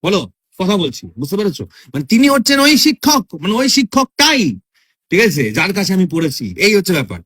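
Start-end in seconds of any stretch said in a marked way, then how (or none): 2.0-4.6 "ওই শিক্ষকটাই" raised high for emphasis; single out "শিক্ষকটাই" with a high, stretched stress; emphatic pointing-out. Shown as a demonstration. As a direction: insist it is "ওই শিক্ষকটাই", pitched up. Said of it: "জোর দিয়ে বলা".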